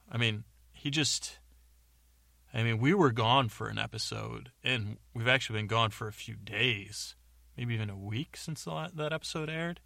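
usual speaking voice

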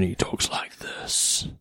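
very slow, hushed attempt at a deep voice; radio voice. Imitating the boss on the phone, slow radio voice. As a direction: drag it out like a radio man. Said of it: "possibly the world's greatest radio voice".

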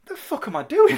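high, loud, sudden disbelief; a wrestler suddenly doubting himself, voice shooting up; alarmed yell. Acted. Imagining Brock Lesnar up there before the jump, alarmed yell.

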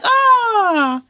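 long high delighted "ah"; high, loud, stretched-out cry of joy; excited greeting. Acted. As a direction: draw it out like greeting old friends. Acting out excited friends reuniting.

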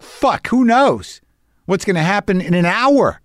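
exasperated dread. Acted. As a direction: cry out in anxious exasperation. Acted anxious thought about future.

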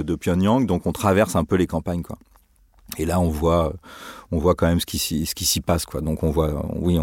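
normal talking voice

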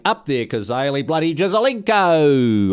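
barrack like a fan in the stands; Aussie footy fan cheer. Pretend. Presenter cheering his team as an Aussie fan.

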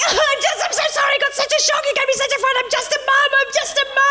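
high, fast, wailing apology; mock sobbing plea. Sobbing to the cop at the car door, high fast wailing plea. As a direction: blubber it out to get off the hook. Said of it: "I just start crying".

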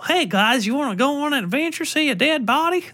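high, chirpy cartoon-kid voice; happy-go-lucky kid. Cheery kid invites friends on an adventure, high and chirpy. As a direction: sell it like a cheery kids' show. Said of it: "happy-go-lucky Stephen Kidd tier".

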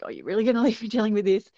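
retold exasperated question, rushed and rising; incredulous complaint. Mum to auntie who is ignoring the trouble, incredulous. Mum left alone with confrontation.

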